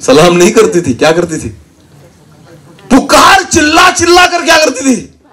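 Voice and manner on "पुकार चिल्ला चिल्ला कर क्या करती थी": shouting. Acted. Preacher to congregation, shouting how demons cried out. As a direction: belt it out like crying spirits. Demons crying out before Jesus.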